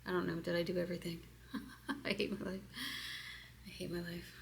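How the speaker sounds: breathy fast whisper of the phrase; soft quick repeated murmur; whispered mantra. Shown as a demonstration. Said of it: "I'm going fast".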